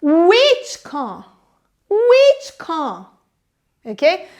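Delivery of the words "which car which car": "Which" drawn out and pitched up, "car" dropping; stress on WHICH. Acted. Officer asks back during a police chase, stress on WHICH. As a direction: hit "Which" hard, asking back. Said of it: "ele enfatiza o which".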